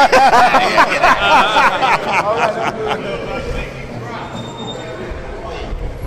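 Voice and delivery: helpless, drawn-out laughter; laughing. Real, not acted. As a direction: burst out laughing and keep going. Joking about Super Chicken mix-up.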